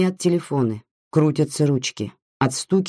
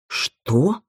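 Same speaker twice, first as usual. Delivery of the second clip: amazed. Acted. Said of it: "изумляется Сикорский".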